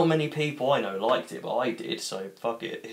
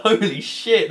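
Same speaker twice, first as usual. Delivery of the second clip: put-on kids' shocked shout; 10 semitones higher, 8 dB louder, slower than usual. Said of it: "They're like".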